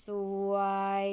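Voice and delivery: ส ว ย spelled out on a flat mid pitch. Shown as a demonstration. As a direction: keep each sound level. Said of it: "with your mid pitch straight".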